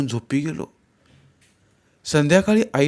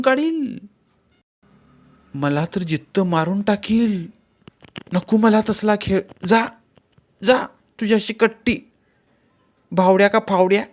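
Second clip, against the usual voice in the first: indignant scolding in a raised pitch; flaring up; 8 semitones higher than usual. A narrator's reading. Girl refuses wedding game and breaks friendship, indignant. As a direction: tell the playmate off, high and heated. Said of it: "पण ती भडकलीच".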